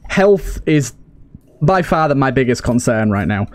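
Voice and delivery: ordinary delivery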